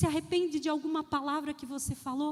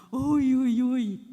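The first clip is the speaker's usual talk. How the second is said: ouch; 4 semitones lower, 9 dB louder, much slower than usual. Acted. Preacher reacts to quarrel.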